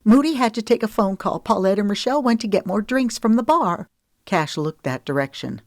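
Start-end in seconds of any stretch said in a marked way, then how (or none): 0.0-3.9 fast, higher and louder, tumbling out; rushed. Acted. Woman rushing to explain, fast and higher. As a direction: blurt it all in a rush. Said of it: "rushed out in a jumbled heap".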